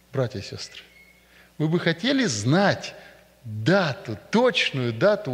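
usual speaking voice

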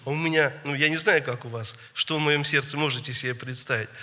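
ordinary talk

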